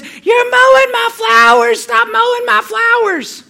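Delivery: high, drawn-out outraged shriek; slow, piercing mock scream; screaming old lady. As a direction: imitate an old woman screaming across the yard. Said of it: "she starts screaming and she says".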